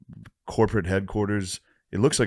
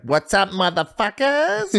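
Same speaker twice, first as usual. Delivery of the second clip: high, drawn-out hype greeting; comedian's stage entrance; 12 semitones higher, 4 dB louder, slower than usual. Retold or imitated. Comedian mimicking his friend hitting the stage, wildly hyped. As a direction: imitate a wild comic bursting on stage. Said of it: "he's like".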